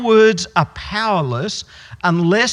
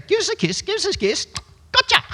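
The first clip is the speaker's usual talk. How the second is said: much higher, squawky parrot-like voice; budgie voice; faster than usual. Retold or imitated. Preacher imitates the budgie asking for a kiss, high bird voice. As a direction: chirp it out like a talking bird. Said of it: "He'd be on your finger and say".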